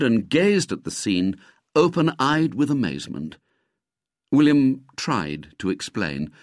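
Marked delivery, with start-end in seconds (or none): none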